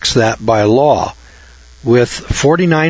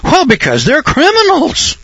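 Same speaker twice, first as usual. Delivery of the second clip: much higher, mock-outraged answer; righteous indignation; 14 semitones higher than usual. Students answering the teacher, mock indignant. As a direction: play the shocked self-righteous student. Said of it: "in holy righteous indignation".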